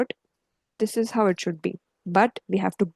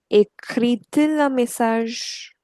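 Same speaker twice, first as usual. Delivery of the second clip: slow, measured model sentence; slow modelling; slower than usual. Shown as a demonstration. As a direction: spell out "Écrit-il un message" slowly, word by word. Teacher models the inverted question.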